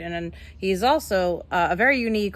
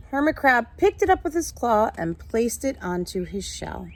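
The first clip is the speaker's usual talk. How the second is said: lively, higher storybook read-aloud; reading to children; 5 semitones higher, 4 dB louder than usual. A narrator's reading. Teacher reading a picture book to her class, lively storytelling.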